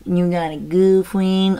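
slow sing-song cat talk. Real, not acted. Owner teasing her cat about a girlfriend, cooing. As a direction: tease the cat fondly, drawn out.

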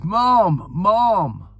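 loud, high-pitched hailing call; re-enacted shout. Retelling his shout to the Queen Mother, high and loud. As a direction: call out as if across a racecourse. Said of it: "so I'll do the voice".

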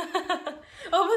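高く大きな笑い; こらえず吹き出す; 笑い声. Real, not acted. 自分の名乗りに笑う. (高く弾けた笑い)

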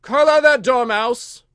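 high, slow, very loud shriek; piercing royal screech. A narrator's reading. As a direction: shriek the order as a furious queen. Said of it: "the queen shrieked out".

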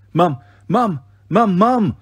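rising excited calls of Mum; calling out. Acted. Children calling their absent mum, eager shouts.

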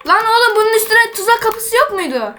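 high, outraged question; exasperated disbelief. Real, not acted. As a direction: demand it in disbelief. Frustrated at a missing trapdoor.

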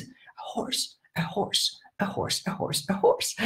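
hushed, soft echo of the class; imitating kids repeating. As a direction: repeat it very softly like pupils. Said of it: "And the kids repeat".

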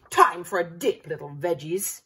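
loud, sneering baddie voice; mock the veggies menacingly; taunting villain. A narrator's reading. Evil pea taunting the little veggies.

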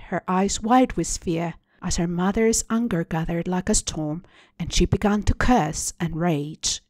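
plain reading voice

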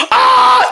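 short, high, loud pretend scream; falling scream. Racer about to land on another, falling scream.

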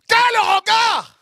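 quel regard shouted high and slow; rapturous shout. Real, not acted. Preacher exalting Yeshua's gaze to the church, rapturous slow shout. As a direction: cry out quel regard with everything you have. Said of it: "Quel regard (murmured take)".

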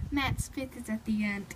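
ordinary talk, nothing marked

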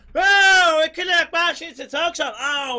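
loud high delighted wow; excited thanks. Real, not acted. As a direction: cheer at the tip. Thanking for tip.

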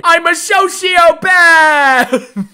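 high, drawn-out mock-maniac yell; hammed-up shout. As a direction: shout it loud and stretch it out. Over-the-top boast to the camera.

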